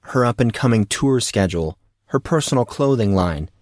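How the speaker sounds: usual speaking voice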